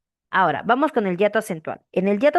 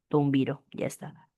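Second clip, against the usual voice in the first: normal talking voice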